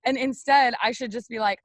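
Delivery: usual speaking voice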